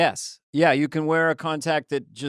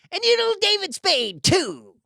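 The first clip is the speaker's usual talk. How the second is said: much higher, louder screechy witch voice; wicked witch; 5 dB louder than usual. Acted. Co-host riffing on the witch bee, high witch screech. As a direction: shriek it like the Wicked Witch threatening.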